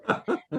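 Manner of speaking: soft chuckle; laugh. Real, not acted. Dancer tells of the stabbed demon looking happy, chuckling.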